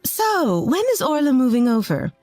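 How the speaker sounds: high, singsong cheery opener; cheerful mother-in-law. A narrator's reading. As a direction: chirp a presumptuous question down the phone. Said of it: "Her voice was cheerful, as always".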